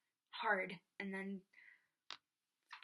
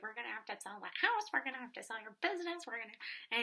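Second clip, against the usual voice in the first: frantic mom; 3 semitones higher, 3 dB louder, faster than usual. Retold or imitated. Mom panicking about money.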